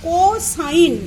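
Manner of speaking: কোসাইন said clearly and loud as "co-sine"; teacher modelling কোসাইন; pronounce cosine. Shown as a demonstration. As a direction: announce কোসাইন firmly to the class. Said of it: "দু নম্বরটা হচ্ছে".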